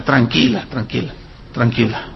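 low, hushed reassurance; calming. Retold or imitated. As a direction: drop down soft to soothe someone upset. Calming an upset girl in story. (voz baja y tranquilizadora)

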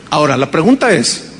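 normal talking voice

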